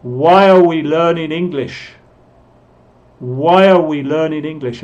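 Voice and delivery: "are" shrunk to uh, linked to "Why"; learners' model sentence with a very weak "are"; reduced "are". Shown as a demonstration. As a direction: run "Why are" together as "why-uh". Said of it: "está muy reducido este A".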